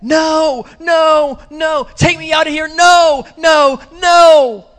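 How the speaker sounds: high pleading scream of no; terrified screaming. Retold or imitated. A man seeing hell screams in terror. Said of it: "he starts screaming".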